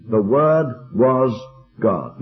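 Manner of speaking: slow, with a pause before "God"; "God" set apart, low and weighty; underlining the deity. Shown as a demonstration. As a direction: hold back, then set "God" down low. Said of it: "underlining his eternal deity".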